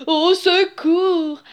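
slow, drawn-out storybook cry of alarm; crying for help. A narrator's reading. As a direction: stretch out a panicked call, mildly acted. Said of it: "il s'écria". (appel au secours effrayé)

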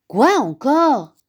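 fed up with being pestered; exasperated. A narrator's reading. Big bear asked again, exasperated. (exaspéré)

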